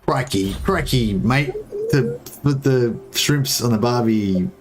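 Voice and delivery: slow, mock Australian Crocodile Dundee accent; Paul Hogan impression. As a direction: ham up the outback Aussie bloke. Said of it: "Paul Hogan, like, Crocodile Dundee".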